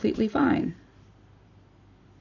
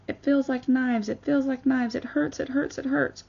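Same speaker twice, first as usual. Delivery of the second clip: put-on higher, pained child voice; imitating daughter in pain; 6 semitones higher than usual. Daughter telling mom her throat feels like knives, mildly imitated pained whimper. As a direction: play the hurting kid, pleading. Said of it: "And she was like".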